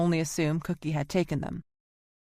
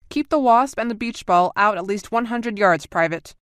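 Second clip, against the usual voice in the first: brisk, louder military order; crisp command; 4 semitones higher, 6 dB louder than usual. A narrator's reading. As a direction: snap out the order firmly. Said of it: "he said crisply".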